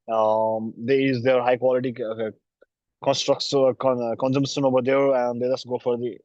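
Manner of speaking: usual speaking voice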